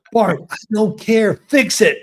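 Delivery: high-pitched, impatient order; retold angry scolding. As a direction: re-enact cursing out a teammate, without shouting. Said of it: "I would curse him out".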